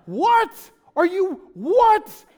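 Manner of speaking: high, loud outraged tantrum voice; childish freak-out. Retold or imitated. Freaking out at the clerk over a bill, childish outrage. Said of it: "I downshifted to a four-year-old".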